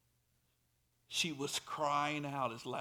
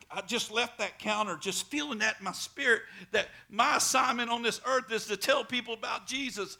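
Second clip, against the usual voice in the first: ordinary talk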